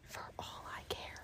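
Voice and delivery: breathy whispered repeat; whispering. Shown as a demonstration. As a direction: whisper it to yourself.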